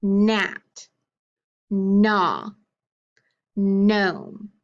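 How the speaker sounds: long held N opening "Gnat", "gnaw" and "gnome"; "Gnat", "gnaw", "gnome" each begun on a drawn-out hummed N; stressed initial sound. Shown as a demonstration. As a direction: stretch the first N of "Gnat", "gnaw", "gnome", with silent G. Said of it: "Can you repeat them with me?".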